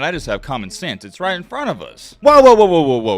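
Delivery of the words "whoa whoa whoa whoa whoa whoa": loud, rising over-excitement; worked up. Real, not acted. Host stops his own heated rant, worked up. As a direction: catch yourself mid-rant. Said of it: "Let me calm down".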